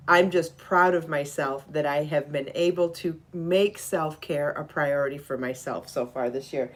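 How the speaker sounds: ordinary talk, nothing marked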